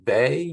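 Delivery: "Bay" said as "vay", for Weil; "Bay" as the French-style name Weil; French-style pronunciation. Shown as a demonstration. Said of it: "I hear some people pronounce his name".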